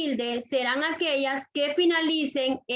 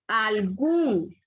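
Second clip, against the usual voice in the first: "algún" with a long, stressed "gún"; teacher's example, weight on "gún" in "algún"; stress on last syllable; 4 semitones lower than usual. Shown as a demonstration. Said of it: "fuerza de voz en la última sílaba".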